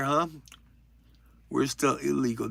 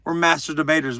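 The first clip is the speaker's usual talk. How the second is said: ordinary talk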